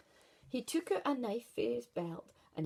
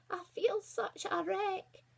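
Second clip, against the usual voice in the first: high, slow put-on granny voice; wolf sweetly faking a granny, drawn out; a wolf disguised as a frail granny, high and slow; 11 semitones higher, 3 dB louder, slower than usual. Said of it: "the wolf said".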